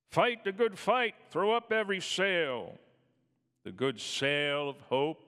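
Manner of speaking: loud, high preaching exhortation; fiery preaching. Acted. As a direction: rouse the congregation. Preaching climax.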